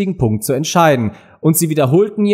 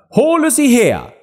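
böse Königin; 12 semitones higher, 3 dB louder than usual. A narrator's reading. Königin befiehlt dem Henker.

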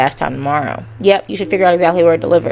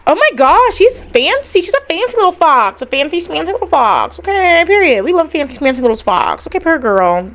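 sing-song fangirl gushing; delighted high-pitched fawning; 10 semitones higher than usual. Real, not acted. Gushing over fancy fox character.

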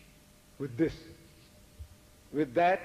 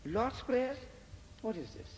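puzzled questioning; 6 semitones higher, 8 dB quieter than usual. Retold or imitated. Retold puzzlement at reading the back.

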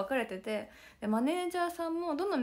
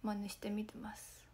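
hoarse whisper; 10 dB quieter, slower than usual. Real, not acted. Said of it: "声ガサガサすぎる".

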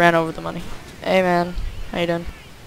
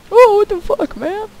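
much higher, loud disbelieving cry; outraged shock; 5 dB louder than usual. Real, not acted. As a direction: shriek it in sheer disbelief. Outraged by game event.

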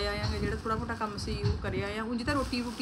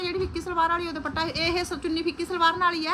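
pitched up and louder to be heard; raised, high voice telling the helper; calling out; 8 semitones higher, 7 dB louder than usual. Real, not acted. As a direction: explain it loudly across the room. Explaining dupatta to helper nearby.

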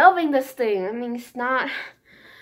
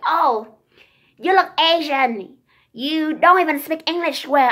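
mildly mocking, higher-pitched put-down; imitating judgmental people; 4 semitones higher, 3 dB louder than usual. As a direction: mimic a judging stranger, a little drawn out. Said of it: "start judging you and be like".

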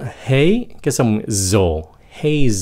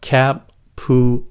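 "cappuccino" slowly split cap, pu, chi, no; syllable by syllable; slower than usual. Shown as a demonstration. Teacher breaking the word apart, slow separate syllables. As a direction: dissect "cappuccino" into four separate sounds. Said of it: "tách ra từ âm mổ xẻ".

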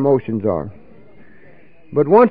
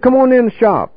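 much higher inviting call; 9 semitones higher than usual. Retold or imitated. Marv inviting him into the barber shop, higher call. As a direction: lift the voice high to invite him in.

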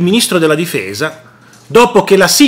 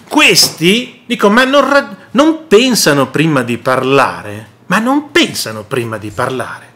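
ordinary talk, nothing marked

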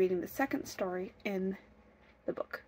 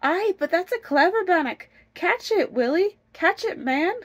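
high, raised-voice urging; excited urging; 9 semitones higher, 8 dB louder than usual. Acted. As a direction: egg the husband on to catch it. Wife urges husband to catch it.

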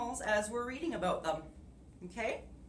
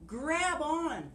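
bear calling; 8 semitones higher than usual. Acted. Sun Bear calls to the trapped animals, higher call. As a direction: urge the animals to grab the branch.

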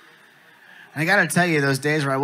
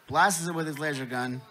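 usual speaking voice

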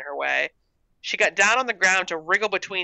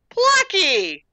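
high, loud cry of surprise; surprised exclamation; 6 dB louder than usual. A narrator's reading. Gertrude hearing her cat beneath the steps, surprised cry. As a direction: exclaim the name, pitch leaping up. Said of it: "Gertrude exclaimed, surprised".

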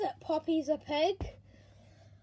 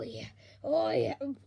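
normal talking voice

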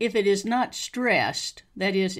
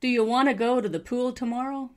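higher-pitched model question, rising at the end; listen-and-repeat model; 5 semitones higher, 4 dB louder than usual. Shown as a demonstration. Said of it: "listen and repeat after me".

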